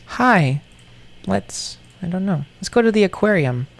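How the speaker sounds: lightly voiced little kid; a hint of a squeaky child; high cutesy voice. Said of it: "when I speak like that".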